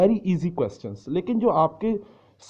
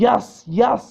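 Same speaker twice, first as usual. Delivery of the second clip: higher, louder eager shout of "yes, yes"; student shouting answer; 8 semitones higher, 8 dB louder than usual. Shown as a demonstration. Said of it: "And you shout". (जोश से चिल्लाकर "हाँ, हाँ")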